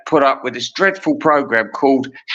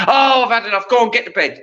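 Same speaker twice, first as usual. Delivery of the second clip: grumpy dad; 8 semitones higher, faster than usual. Retold or imitated. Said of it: "He'd say".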